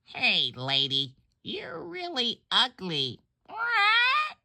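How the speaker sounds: high squawky parrot taunting the lady. Acted. Parrot in the pet store window mocking a passing lady, high squawky voice. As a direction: squawk it out like a cheeky parrot, ending in a shrill What. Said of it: "The parrot says to her".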